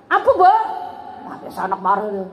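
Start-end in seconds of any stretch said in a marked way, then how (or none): 0.1-0.5 higher, louder snapped reply; sulky angry child. Acted. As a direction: throw it back like an annoyed teen. Angry child answers door.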